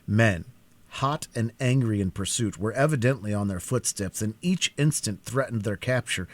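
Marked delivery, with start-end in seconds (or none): none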